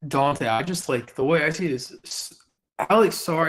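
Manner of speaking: ordinary delivery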